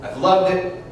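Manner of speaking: smooth jazz radio DJ voice; radio host. Acted. DJ on air to his Sunday listeners, radio host read. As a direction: talk to your listeners like a late-night host.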